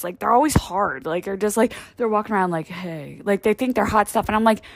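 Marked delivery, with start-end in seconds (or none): 2.7-3.2 low, soft cocky "Hey"; lazy come-on greeting, much lower; casual strutting guy saying hey; mock cool guy. Gym guys strutting, acted greeting.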